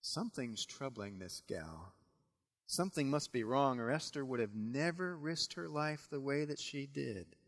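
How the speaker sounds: soft, low musing to himself; the king privately puzzling it out; the king wondering quietly about Esther; quiet worried king. Acted. King's private worry.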